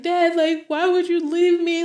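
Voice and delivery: high, hurt plea to dad; retold tearful plea. Daughter retelling her plea at dad's grave, mock tearful. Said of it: "and I am crying".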